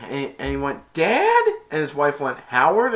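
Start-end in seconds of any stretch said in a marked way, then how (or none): 0.9-1.4 high, loud disbelieving query; surprised, pitched-up question; astonished question. Retold or imitated. Acting kid's astonished question. 2.5-2.9 raised, louder questioning name; surprised question. Retold or imitated. Acting wife's surprised question.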